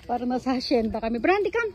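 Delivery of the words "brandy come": high-pitched call to a pet; calling the dog. Real, not acted. Calling her dog to come.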